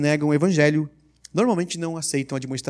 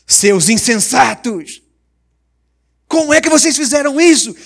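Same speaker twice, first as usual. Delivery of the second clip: high, loud, slow rebuke of the foolish; energetic reading; 8 semitones higher, 9 dB louder, slower than usual. Shown as a demonstration. Preacher rereads Paul to his congregation, loud and high. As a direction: thunder it like an outraged apostle. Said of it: "A leitura tem que ser um pouquinho mais enérgica".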